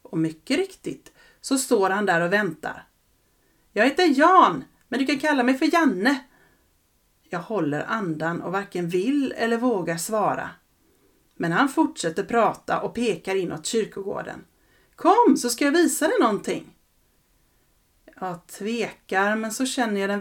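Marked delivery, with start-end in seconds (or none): 3.7-6.2 put-on eager boy. Boy introduces himself eagerly. 15.0-16.8 put-on beckoning boy. Boy beckons girl into graveyard.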